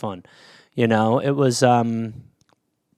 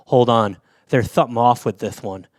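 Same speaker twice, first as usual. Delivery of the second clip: low lisping imitation; lisped, low mock caution; cautious friend; 7 semitones lower than usual. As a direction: mimic a friend's hushed warning, with a lisp. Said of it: "Steve stops me and he goes".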